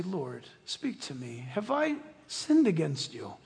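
soft anxious plea to God; hushed prayer. Retold or imitated. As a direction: pray it quietly on your knees. Believer praying on their knees.

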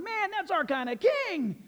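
very high, drawn-out acted cheer; cheering crowd. Preacher voices the people watching David dance, jubilant. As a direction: whoop it up like an admiring crowd. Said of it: "all the people are going".

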